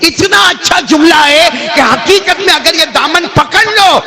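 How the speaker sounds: high, impassioned sermon cry; full-force preaching. Acted. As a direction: preach it at a high, ringing pitch. (پرجوش خطیبانہ انداز)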